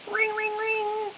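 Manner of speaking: slow, high sung ring ring; high drawn-out mock phone ring. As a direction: imitate an old phone ringing. Imitating old house phone.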